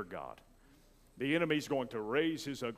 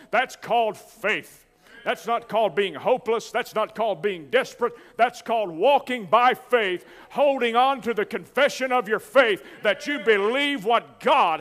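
raised, driving pulpit delivery; full-force preaching; 7 semitones higher, 10 dB louder than usual. Real, not acted. Preacher telling the church that prayer is a battle, full-force preaching. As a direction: preach it hard to the congregation.

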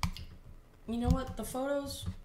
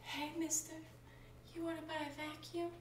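soft high salesman voice; 7 semitones higher, 11 dB quieter than usual. Pretend. Pretending the vacuum-selling bot is talking, soft high voice. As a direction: coo it like a timid salesman.

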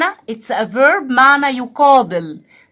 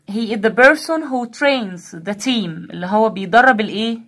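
ordinary talk

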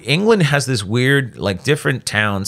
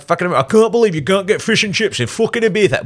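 put-on high-pitched caricature of a northern English accent; 8 semitones higher than usual. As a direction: moan like a tourist from the north of England. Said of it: "people from the north of this country".

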